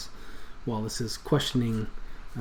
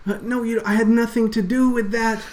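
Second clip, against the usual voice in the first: much higher, panicked plea; panicked denial; 10 semitones higher, 4 dB louder than usual. Acted. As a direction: deny it in a panic. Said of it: "is saying".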